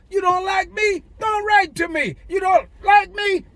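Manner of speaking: taunting shout at the haters; defiant shout. Real, not acted. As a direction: throw it at them, daring. Defiant rant at critics.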